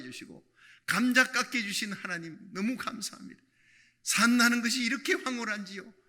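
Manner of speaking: loud, rising thanksgiving; grateful prayer. Retold or imitated. Woman thanking God over the potato, swelling with joy.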